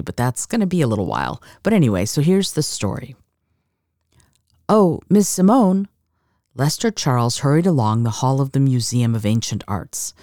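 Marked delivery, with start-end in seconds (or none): 4.7-5.9 raised, higher-pitched call for attention. Acted. Accountant hurrying after a woman in a museum, calling out. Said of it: "he called out again".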